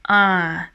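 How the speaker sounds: "Uh" falling low, as if disappointed. Shown as a demonstration. Teacher showing learners the low tone, disappointed "Uh". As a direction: drop "Uh" down in a sigh of disappointment. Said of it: "that sound is low tone".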